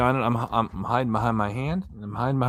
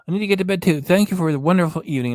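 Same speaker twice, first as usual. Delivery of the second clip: ordinary delivery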